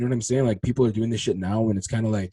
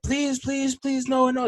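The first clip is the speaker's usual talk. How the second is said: pleading wife; 13 semitones higher, much slower than usual. Retold or imitated. Wife begging the two men to stop, pleading. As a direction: plead in a high, put-on voice. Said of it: "the whole time his wife's like".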